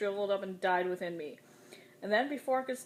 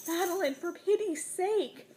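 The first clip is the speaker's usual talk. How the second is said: desperate plea; 11 semitones higher, 4 dB louder than usual. A narrator's reading. Friend pleads desperately.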